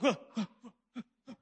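mock panicked wake-up call; urgent warning. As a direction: pull the driver back from the cliff. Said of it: "It's like".

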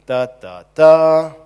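loud sung da da da of doom; booming mock doom fanfare. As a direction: belt out a dramatic doom fanfare. Dramatic doom sound effect.

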